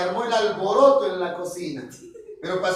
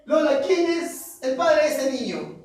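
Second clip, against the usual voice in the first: higher, slow demanding question; demanding Pascual; 5 semitones higher, slower than usual. Acted. Jealous husband demands to know the father. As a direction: press her for the name.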